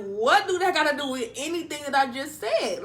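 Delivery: exasperated, punching out the question; incredulous. Retold or imitated. Retold baffled reply to non sequitur.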